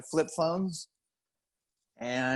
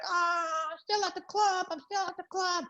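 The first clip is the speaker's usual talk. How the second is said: put-on girlfriend's excuse; 13 semitones higher than usual. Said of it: "she was like".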